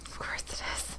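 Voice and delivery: under-the-breath whisper; wry whisper. Real, not acted. Player reacting to Omin chasing her, whispered aside. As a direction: mutter it knowingly under your breath.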